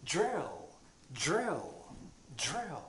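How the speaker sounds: "Drill" said the English way, dr as a curled ju. Shown as a demonstration. Teacher modelling the correct English "drill". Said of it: "それにジュを足します".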